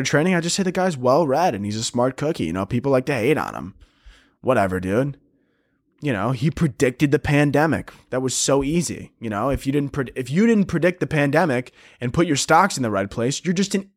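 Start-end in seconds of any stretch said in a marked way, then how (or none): none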